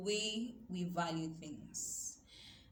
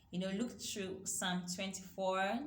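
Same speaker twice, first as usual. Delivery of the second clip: ordinary talk, nothing marked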